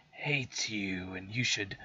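sinister witch whisper. Retold or imitated. Evil book lady whispering hatred to the boy, hushed. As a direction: whisper it like a temptress. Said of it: "she's just like".

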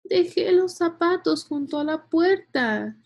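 put-on high-pitched child's complaint; complaining. A narrator's reading. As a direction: protest like an upset storybook girl. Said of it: "se quejó Julia".

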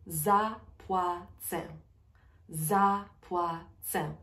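drawn out, syllable by syllable; slow clear demo; slow teaching pace. Shown as a demonstration. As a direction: stretch it out slowly for learners. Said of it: "slowed teaching repeat".

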